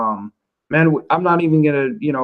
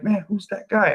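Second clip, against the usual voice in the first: mock freaked-out question; imitated tripping out; 7 semitones higher, 3 dB quieter than usual. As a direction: mimic puzzled viewers in a higher voice. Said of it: "people were tripping out".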